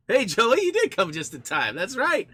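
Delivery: loud, high call out to a friend; excited greeting. Real, not acted. Streamer greets Joey as he joins the chat, loud and high. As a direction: hail him like he just walked in.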